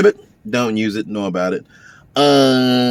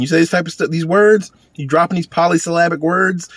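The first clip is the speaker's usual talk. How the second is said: higher pitch on the big words; voice going up; 6 semitones higher, 3 dB louder than usual. Shown as a demonstration. Man describing an accused liar, voice going up. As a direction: let the voice climb as you describe it. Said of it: "your voice goes up".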